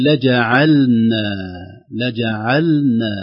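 لجعلنا slowly, the silent lam before the nun sounded clearly. Shown as a demonstration. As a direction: make the lam of لجعلنا heard. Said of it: "ظهور اللام الساكنة".

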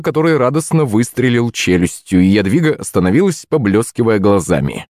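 ordinary delivery, nothing marked

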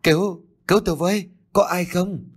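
high, urgent plea for rescue; hurried, high-pitched distress call; cry for help. A narrator's reading. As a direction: call out in alarm, pitched well up. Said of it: "Tiếng kêu cứu vang lên không xa".

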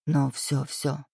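soft, low comforting hush; calm her down gently; soothing. Acted. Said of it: "утешал парень". (тихо и ласково утешая)

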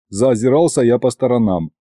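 ordinary delivery, nothing marked

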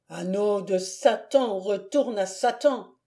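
ordinary delivery

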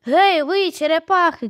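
put-on high, playful call to the boys; teasing shout. A narrator's reading. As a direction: call out mockingly to those lagging behind. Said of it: "крикнула вона хлопцям".

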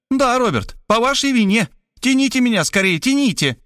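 high, urgent pleading; woman urging Robert to pull her out; reproachful urgency. Acted. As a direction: blame him and hurry him. She urges him to pull.

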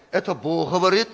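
ordinary talk, nothing marked

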